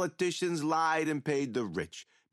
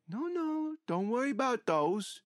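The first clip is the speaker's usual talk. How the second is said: mock reassurance; 8 semitones higher than usual. Killer mockingly comforts the paralysed priest about sharpened tools. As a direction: soothe him like a nurse while holding the blades.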